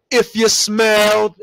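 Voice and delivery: loud, drawn-out announcer-style bellow; imitated wrestler's catchphrase, stretched and shouted; entrance catchphrase shout.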